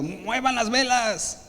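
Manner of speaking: a slow, high, drawn-out captain's cry; captain's shouted order. Retold or imitated. Captain shouting at his crew, slow, high call. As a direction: bellow the order across the deck. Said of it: "y dice gritando".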